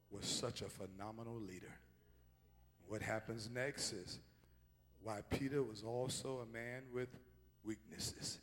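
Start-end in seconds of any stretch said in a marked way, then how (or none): none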